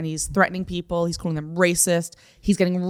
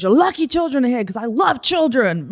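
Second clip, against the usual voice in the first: higher-pitched mock threat; raised, pitched-up rant in imitation; retold ranting; 6 semitones higher, 3 dB louder than usual. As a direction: imitate the aggressive man addressing a child's presence. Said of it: "he's still, like".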